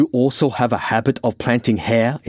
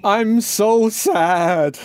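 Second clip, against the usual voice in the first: very slow, high drawn-out wail; miserable howl; 13 semitones higher, much slower than usual. Acted. Francis breaks down in the car park, howling. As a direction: stretch every word into a howl. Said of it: "he howled".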